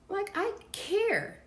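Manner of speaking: high, slow mocking aside; sarcastic. Kim scoffing at Sean's reason, sarcastic. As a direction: sneer it sarcastically. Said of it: "sarcastic aside".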